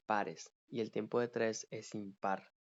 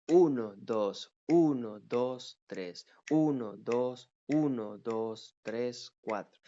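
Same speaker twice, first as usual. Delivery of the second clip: broken uneven count; 5 dB louder, slower than usual. Shown as a demonstration. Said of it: "no los estoy dividiendo iguales".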